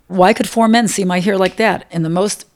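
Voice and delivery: normal talking voice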